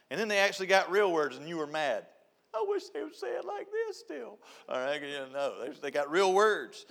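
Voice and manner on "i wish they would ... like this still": high, far mock mother's voice; a mom sighing for the baby talk; wistful mom. Mom mocking wistfully to the congregation.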